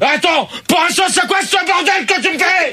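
real high-pitched furious shout; furious yelling. Real, not acted. Host raging at his team over the mess, furious yelling. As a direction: blow up in exasperation. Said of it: "Putain, gueule pas".

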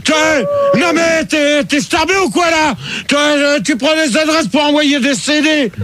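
worked-up, high-pitched rant; angry scolding. Real, not acted. Host scolds colleague for taking addresses for CDs, angrily. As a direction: yell it in outraged disbelief, pitch held high.

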